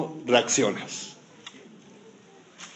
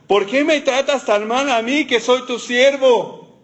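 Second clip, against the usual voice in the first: high, slow, complaining plea; mock whiny complaint to the Lord; whiny Moses; 8 semitones higher, slower than usual. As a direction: whine it like a put-upon servant. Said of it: "Era un poco quejoso".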